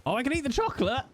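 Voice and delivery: delight in the dodgy chocolate; high gleeful voice. Pretend. High bright voice.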